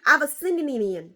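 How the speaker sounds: "Avasinian" said quickly in one go. Shown as a demonstration. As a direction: rattle off "Avasinian" briskly. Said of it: "maybe I should say it faster".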